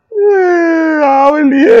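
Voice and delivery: sudden high-pitched dismay; dismayed squeak. Real, not acted. Rider finding his helmets won't pair, high dismayed cry. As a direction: squeal it out in disbelief.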